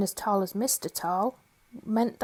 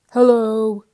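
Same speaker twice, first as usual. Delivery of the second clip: much louder hello; loud cry; 11 dB louder than usual. Acted. Mister Greedy greeting Mister Tall, loud call. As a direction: cry out loudly. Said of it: "he cried".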